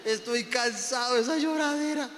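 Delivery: hammed-up tearful pleading; imitating God crying. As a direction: whine in exasperation through fake sobs. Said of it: "me imagino a Dios llorando".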